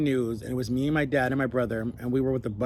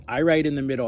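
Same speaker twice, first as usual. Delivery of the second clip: normal talking voice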